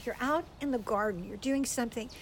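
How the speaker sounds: ordinary delivery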